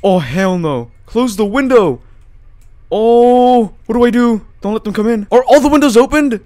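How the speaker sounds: high, loud alarm; panicked. Real, not acted. Gamer finding Horatio coming through the windows, panicked. Panic as figure enters house.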